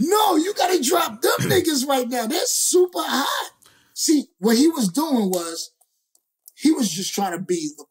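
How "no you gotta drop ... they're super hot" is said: urgent insistence. Retold or imitated. De La Soul defending them.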